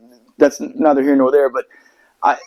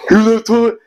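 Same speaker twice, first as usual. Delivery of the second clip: higher-pitched mocking smart-ass imitation; 7 semitones higher, 3 dB louder than usual. Mimicking the SEAL's parting remark, smart-ass. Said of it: "he had to be a smart ass".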